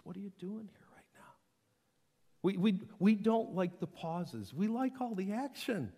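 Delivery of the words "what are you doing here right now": quick, very soft whisper; quiet voice of God. Acted. God speaks in the pause, whispering a question. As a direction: ask it under your breath. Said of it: "in the quiet that the voice of God speaks".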